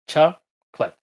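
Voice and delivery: chocolate said CHAK-let, two syllables. Shown as a demonstration. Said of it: "Casi siempre lo escuchamos con dos sílabas".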